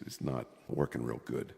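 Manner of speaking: ordinary talk, nothing marked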